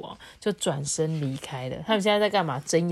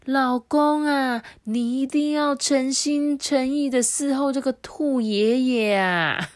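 deer mother; 7 semitones higher, 3 dB louder, slower than usual. A narrator's reading. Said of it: "鹿妈妈就说".

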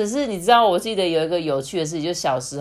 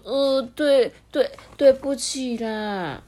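put-on higher, halting child voice, slow at the end; apologetic child; 8 semitones higher, slower than usual. A narrator's reading. Little Lemon says sorry to doctor auntie, sheepish. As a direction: stumble into a drawn-out sorry.

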